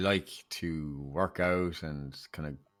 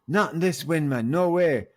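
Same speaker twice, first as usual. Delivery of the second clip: higher, louder no way; firm refusal; 8 semitones higher, 6 dB louder than usual. Retold or imitated. Paddy refusing the wind, higher and louder. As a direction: push back hard over the wind.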